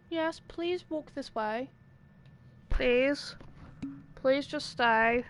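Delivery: slow, drawn-out pleading; pleading with a character to follow; slow coaxing plea. Real, not acted. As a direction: coax them gently, word by word.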